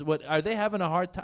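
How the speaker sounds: ordinary talk, nothing marked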